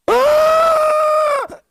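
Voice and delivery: high loud yelp as the air is knocked out; winded gasp-yell. Retold or imitated. Kid punched in the gut, high loud winded yelp. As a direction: cry out as the punch lands. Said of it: "And it goes".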